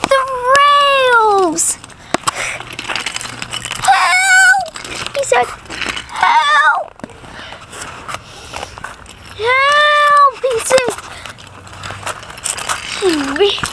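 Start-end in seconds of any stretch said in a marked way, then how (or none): 3.8-4.7 shouted call for help. Acted. Character calls for help. 6.2-10.4 calling for help. Acted. Character calls for help.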